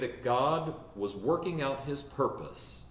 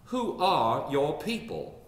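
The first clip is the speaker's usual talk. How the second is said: slow imitated Southern drawl; elderly Richmond lady; 3 semitones higher, slower than usual. Elderly lady asks about family tree, slow Richmond drawl. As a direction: ask it like a Richmond matron, drawn out with a Southern accent. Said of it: "So she asked".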